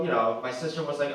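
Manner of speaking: normal talking voice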